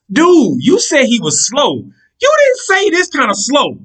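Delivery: high, slow, stretched disbelief; exasperated complaint. Retold or imitated. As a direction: drag out the outrage. Complaining to friend.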